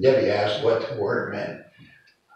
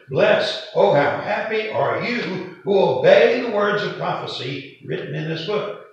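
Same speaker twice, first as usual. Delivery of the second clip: raised pitch, louder, lifted phrases; joyful proclamation; 8 semitones higher, 5 dB louder than usual. Retold or imitated. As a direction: proclaim the blessing with delight. Rereads the verse as a joyful exclamation.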